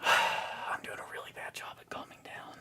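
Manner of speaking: breathy whispered sigh, barely voiced. Real, not acted. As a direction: whisper it to yourself, worn out. Sighing, trying to calm down.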